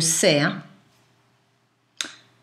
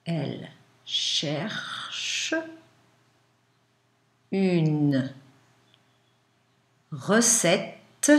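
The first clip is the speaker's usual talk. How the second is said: slow, word-by-word dictation; dictating; 3 dB louder, much slower than usual. Shown as a demonstration. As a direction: dictate slowly with long pauses.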